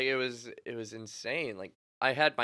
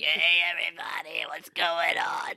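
strained, higher croak, dragged out; imitating lost voice; 5 semitones higher, 3 dB louder than usual. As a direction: push out a greeting with no voice left. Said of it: "my voice just disappears".

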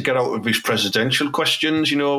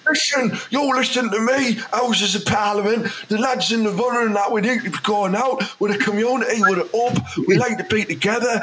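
much higher mock voice, lecturing Parliament; mayor's BBC interview impression; 9 semitones higher than usual. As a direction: send up a pompous mayor on the telly, pitched high. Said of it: "That interview he did with B B C News".

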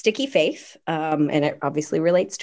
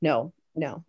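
ordinary delivery

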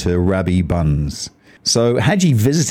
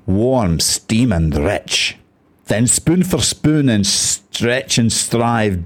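dramatic verse reading with a put-on Scottish burr; Scottish accent. Shown as a demonstration. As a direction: recite the poem in an attempted Scottish accent. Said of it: "attempt to read this in a Scottish accent".

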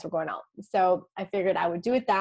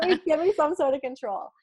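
higher-pitched playful plea; pleading for control; 7 semitones higher than usual. Retold or imitated. Wife half-jokingly asks God for some control, mock pleading. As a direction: plead lightly, voice lifted high.

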